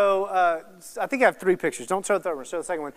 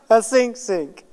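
mock-tearful boy, raised pitch and laughing; hammed-up child's whimper, louder, with a laugh; imitated crying child; 2 semitones higher, 5 dB louder, slower than usual. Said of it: "and he's crying".

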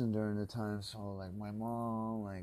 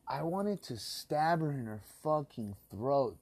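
loud, higher furious outburst; raging, voice raised high; real rage; 5 semitones higher, 5 dB louder than usual. Real, not acted. Furious rant about stolen card.

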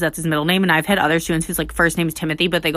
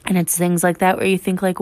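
ordinary talk

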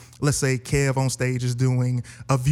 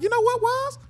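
much higher, laughing delight; gleeful yes; 4 dB louder, slower than usual. Retold or imitated. Replying to Wise, laughing and overjoyed. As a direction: squeal it out with laughter at the lucky offer. Gleefully accepting Wise's camera offer.

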